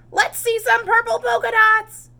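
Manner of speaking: high, loud playground shout; taunting yell. Acted. Classmate taunting Camilla, high loud yell. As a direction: yell it out teasingly. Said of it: "One yelled".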